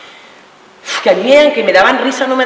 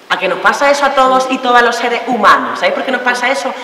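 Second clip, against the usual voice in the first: normal talking voice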